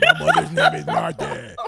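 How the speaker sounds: strained cracking high croak; wounded frog voice. Shown as a demonstration. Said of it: "that's how I sounded".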